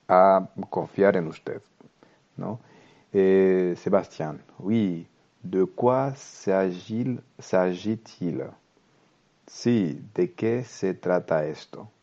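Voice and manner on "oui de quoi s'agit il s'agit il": slow, careful French reading for learners. Shown as a demonstration. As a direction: read each word slowly so students can follow. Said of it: "leer el diálogo muy despacio". (lectura muy lenta y clara)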